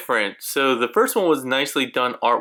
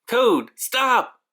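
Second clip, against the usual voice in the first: high, sharp warning call; urgent shout; 11 semitones higher than usual. Acted. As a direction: stop him from touching it.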